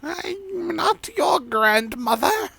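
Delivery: put-on very high, slow old lady denying; indignant old granny, drawn out; high, slow old woman voice.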